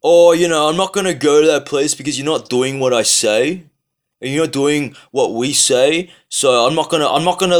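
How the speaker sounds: put-on sarcastic take-off of a self-important boycotter; mocking petulant Aussie. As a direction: whine it like a sulking child making threats.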